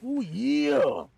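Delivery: high, loud over-the-top wrestler growl of "Oh, yeah"; hyped wrestler promo. Retold or imitated. Streamer hamming up a wrestler snack ad, loud and high.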